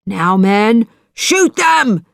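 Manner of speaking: high, loud order to fire; shout. A narrator's reading. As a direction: bark the command, rising high. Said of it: "he shouted".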